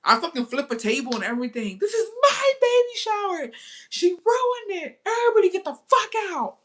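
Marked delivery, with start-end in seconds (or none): 1.8-6.6 high, loud hammed-up rage; mock furious shout. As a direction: throw them all out of the shower. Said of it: "Then I start throwing shit".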